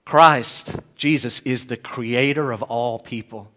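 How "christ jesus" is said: ordinary delivery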